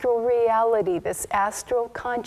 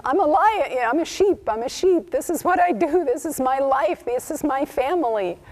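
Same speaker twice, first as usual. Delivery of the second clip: higher, bleating lion who thinks he's a sheep; 6 semitones higher, faster than usual. Acted. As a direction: bleat it out like a timid lamb, higher. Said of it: "the lion spoke in sheep language and bleated".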